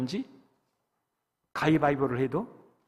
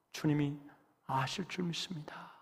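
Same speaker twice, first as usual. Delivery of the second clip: hushed confession; 10 dB quieter, slower than usual. Acted. Confession drops to hush.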